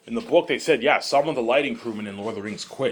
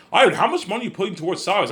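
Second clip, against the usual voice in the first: shocked Peter; 10 semitones higher, 4 dB louder, faster than usual. Retold or imitated. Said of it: "Peter will be shocked".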